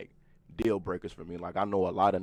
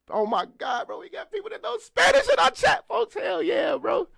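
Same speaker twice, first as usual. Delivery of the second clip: much higher and louder, laughing and whooping with real delight; excited laughing shout; 9 dB louder than usual. Real, not acted. Streamer cheering his chat for knowing Spanish, shouting with delight.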